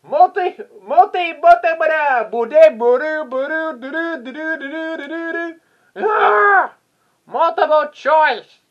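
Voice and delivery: high, loud runaway babble into a shout; hammed-up yelling babble. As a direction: babble wildly up high, then yell it out. Hammed-up yelling after flubbed line.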